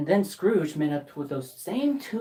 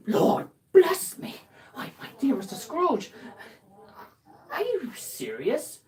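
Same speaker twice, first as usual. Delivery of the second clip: astonished gentleman; 7 semitones higher than usual. Acted.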